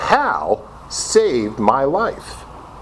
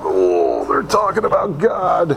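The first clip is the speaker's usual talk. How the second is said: higher, sing-song mock dread; mock alarm; 4 semitones higher, slower than usual. Newcomer dreads God talk, higher mock dread. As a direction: echo it as if horrified. Mocking echo of own line.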